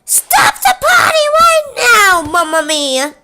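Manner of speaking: high, loud cartoon-plumber shout; Mario voice. Mario shouting at the party to stop, high and loud. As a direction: bellow like Mario crashing the party. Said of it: "it was like".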